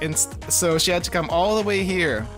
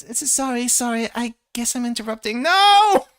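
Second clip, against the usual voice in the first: put-on flustered anime girl; 8 semitones higher than usual. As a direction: squeal it out in a fluster. Flustered girl in visual novel scene.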